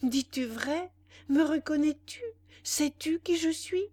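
loud, high, urgent questions; frantic crying out. A narrator's reading. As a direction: fire off questions like a man gone mad. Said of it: "s'écria Bénédicte devenu fou".

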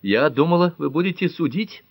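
high-pitched, puzzled protest. A narrator's reading. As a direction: object with pitch lifted throughout. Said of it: "запротестовала Пэт".

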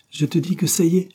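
exclaiming. A narrator's reading. Painter boasting to Christine about his painting, excited and high. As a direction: announce success with sudden fire. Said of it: "s'écria-t-il".

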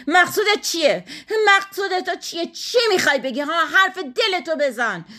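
high-pitched, menacing demand; threatening. A narrator's reading. Wife confronting her accusing husband, threatening. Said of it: "آهنگ تهدید آمیز گفت".

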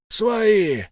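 high, long call; yell. A narrator's reading. Wolf telling the shooters he is one of them, yelling. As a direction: holler to be recognised as a friend. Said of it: "завопил волк".

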